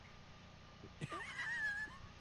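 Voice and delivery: high squeaky giggle; stifled giggles. Real, not acted. Laughs at Bible joke.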